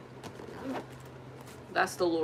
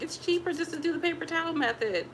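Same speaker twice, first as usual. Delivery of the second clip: higher, lighter self-quote; quoting herself; 8 semitones higher than usual. Retold or imitated. To viewers recalling her thrifty choice, pitched up. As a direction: recall your own thought, pitched up. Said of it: "So I was like".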